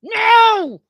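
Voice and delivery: sudden shouted no after a low growl; imagined outburst at reporters; angry brush-off. Retold or imitated. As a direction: shove the crowd off, ending in a yell. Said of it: "I would be like".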